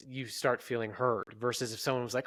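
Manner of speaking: ordinary talk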